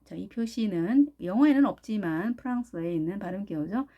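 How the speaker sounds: usual speaking voice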